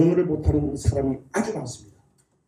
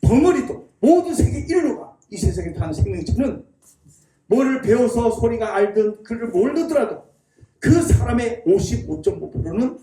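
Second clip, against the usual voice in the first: usual speaking voice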